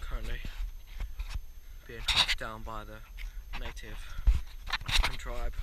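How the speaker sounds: hushed wildlife presenter whisper; nature documentary whisper. Pretend.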